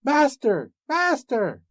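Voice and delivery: very high call; crying out. A narrator's reading. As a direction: shriek for your master. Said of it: "the harp cried out".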